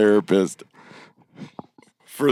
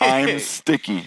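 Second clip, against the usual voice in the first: slow deadpan reading; 3 semitones higher, slower than usual. Retold or imitated. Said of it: "And that's all it said".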